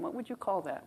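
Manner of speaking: usual speaking voice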